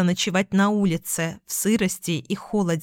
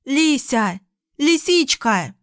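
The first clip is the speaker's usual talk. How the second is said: calling out; 6 semitones higher, slower than usual. A narrator's reading. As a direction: call out for the lost dog. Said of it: "кричала в темноту".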